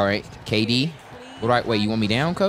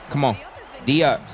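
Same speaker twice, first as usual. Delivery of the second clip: ordinary delivery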